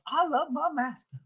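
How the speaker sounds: acted slave, high drawn-out Southern voice; slave's declaration. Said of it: "as the slave says".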